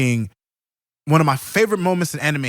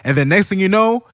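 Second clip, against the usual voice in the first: ordinary delivery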